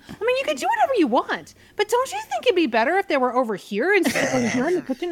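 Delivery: very high, squeaky mock politeness. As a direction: imitate a pushy squeaky-voiced woman. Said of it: "little Miss Squeaky Voice".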